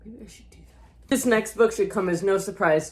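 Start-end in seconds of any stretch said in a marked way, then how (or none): 0.1-1.1 soft unvoiced whisper to herself; whispered aside. Real, not acted. Vlogger whispering an aside to herself about the sequel. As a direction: breathe it like a private note to self.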